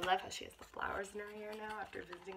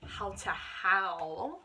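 slow storybook read; 3 semitones lower, 10 dB louder, much slower than usual. Acted. Slow dramatic reading.

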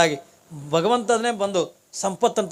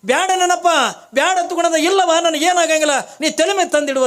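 pleading holy man; 9 semitones higher, 4 dB louder than usual. Acted. Jangama pleads, refuses to be spared.

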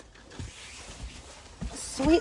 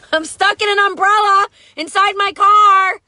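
calling out for help; 7 semitones higher, 5 dB louder than usual. Real, not acted. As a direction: shout it out, higher and louder. Mom stuck in car, calling out.

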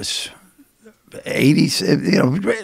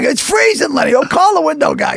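high mock shout at Lenny; mock yell; 8 semitones higher than usual. Roommates yelling to call the window guy, mock shout. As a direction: holler it like a freezing roommate.

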